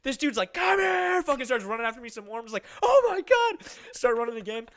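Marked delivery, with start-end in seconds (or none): 0.5-1.2 high, loud drawn-out bellow; imitated furious shout. Furious dad starting to chase the kid, imitated shout. 2.8-3.7 high, loud cry of alarm; mock panic. Kid chased across the neighborhood, hammed-up panic.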